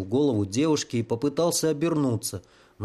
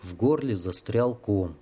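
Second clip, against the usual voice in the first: usual speaking voice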